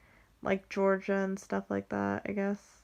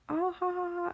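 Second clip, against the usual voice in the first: high mock "ha ha" laugh; imitated laughter; 10 semitones higher than usual. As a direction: mimic relatives laughing, pitched up. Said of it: "they'll, like, start laughing".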